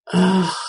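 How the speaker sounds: long breathy groan of annoyance; exasperated ugh. Real, not acted. Annoyed by inner critical voice.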